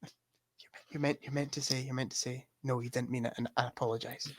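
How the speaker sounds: feed the line under your breath; hushed prompting. Pretend. Co-host prompts a friend to apologize, hushed aside.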